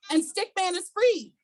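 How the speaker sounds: ordinary delivery, nothing marked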